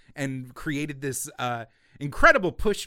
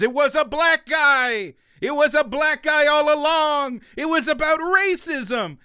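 excited shout; 12 semitones higher, 7 dB louder than usual. Real, not acted. Host shouting the story's twist at his co-host, excited yelling. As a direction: shout it much higher and louder, slowing down at the end.

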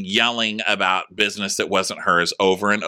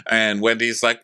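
ordinary talk, nothing marked

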